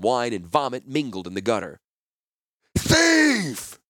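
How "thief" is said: very high, loud bellow of thief; roaring merchant. Acted. Angry wine seller roars at the fleeing thief. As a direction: shriek it after the boy. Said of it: "the wine seller roared".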